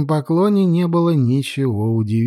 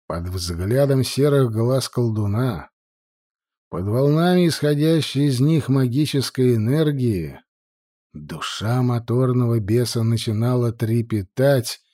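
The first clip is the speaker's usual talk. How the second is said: ordinary delivery, nothing marked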